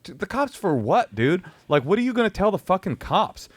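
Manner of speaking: high, exasperated retort; incredulous. Retold or imitated. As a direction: scoff at the driver in disbelief. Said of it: "I'm like".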